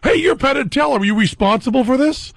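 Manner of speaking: high, eager reporter voice; news guys. Retold or imitated. Comedian mimics reporters rushing at him after the evacuation, high and eager. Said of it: "the news guys run up and go".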